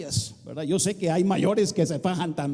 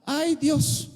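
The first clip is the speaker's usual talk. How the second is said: higher, drawn-out "ay, Dios"; a mock moan to God; whiny complaint; 7 semitones higher than usual. Shown as a demonstration. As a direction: moan it like a reluctant believer. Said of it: "Así decimos aquí".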